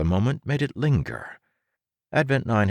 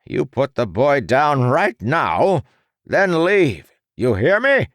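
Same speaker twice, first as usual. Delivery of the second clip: slow, higher and louder furious snarl; snarling threat; 7 semitones higher, 6 dB louder, slower than usual. A narrator's reading. The doctor snarls at his creation, furious. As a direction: snarl each word as an order. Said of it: "he snarled at his creation".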